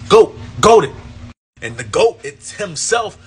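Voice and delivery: normal talking voice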